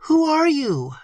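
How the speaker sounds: high, frightened demand; terrified and indignant. A narrator's reading. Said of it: "in a tone of mingled terror and indignation".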